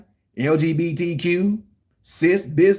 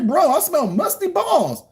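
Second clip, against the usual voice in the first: mock disgusted dad; 11 semitones higher, slower than usual. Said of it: "Me telling my son".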